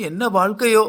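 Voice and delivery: usual speaking voice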